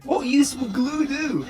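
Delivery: puzzled, draw it out; high slow Papyrus. Acted. Papyrus questions Sans's word.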